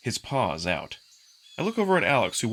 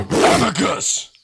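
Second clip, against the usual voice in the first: much louder, drawn-out angry yell; furious shout; 9 semitones higher, 10 dB louder, much slower than usual. Acted. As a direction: roar the name across the yard. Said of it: "the volume and fury in his voice".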